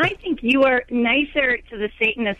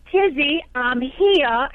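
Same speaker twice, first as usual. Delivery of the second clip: higher-pitched, British-tinged Southern drawl; bad Southern accent; 4 semitones higher, much slower than usual. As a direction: imitate a child's hammy Southern-belle audition. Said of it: "doing this southern accent, sort of Britishy".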